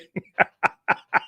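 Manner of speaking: wheezy, voiceless laughing fit; inside-joke laughter. Real, not acted. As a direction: crack up, almost without voice.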